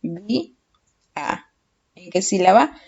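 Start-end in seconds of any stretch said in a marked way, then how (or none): 0.0-1.5 "Sa, vi, a" split by clear pauses; syllable by syllable. Shown as a demonstration. Teacher separating a word for the class, syllable by syllable. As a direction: say "Sa... vi... a" one syllable at a time. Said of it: "Paramos en sílabas".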